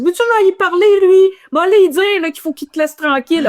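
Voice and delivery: high mock Quebecer teasing voice; imitating a fellow Quebecer. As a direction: mimic him high and amused. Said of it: "Il dit".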